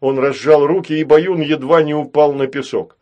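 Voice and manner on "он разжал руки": ordinary delivery, nothing marked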